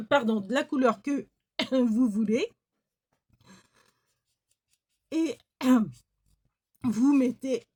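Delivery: coughing fit mid-sentence; choking on a swallow; coughing after swallowing wrong. Real, not acted. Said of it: "pardon, j'ai avalé de travers".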